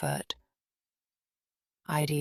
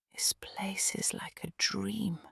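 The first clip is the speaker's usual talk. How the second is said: higher, airy whisper full of wonder; breathed; 5 semitones higher, 4 dB quieter than usual. A narrator's reading. Clara seeing the mountain hut, awed breathy whisper. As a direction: breathe it out in awe. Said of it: "she breathed".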